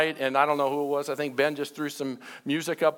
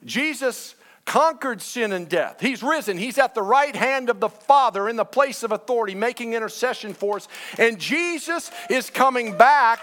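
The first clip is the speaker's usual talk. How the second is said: higher-pitched, rising sermon climax; emphatic preaching; 5 semitones higher, 4 dB louder than usual. Real, not acted. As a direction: proclaim it to the congregation with building force. Preaching climax about risen Christ.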